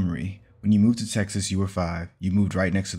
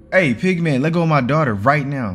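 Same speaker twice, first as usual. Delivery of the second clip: loud, high-pitched order; angry father yelling; furious shout; 7 semitones higher, 8 dB louder than usual. A narrator's reading. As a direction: bellow a threat to get off your child. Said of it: "he shouted at Bubba".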